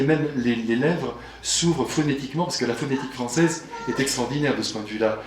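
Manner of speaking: ordinary delivery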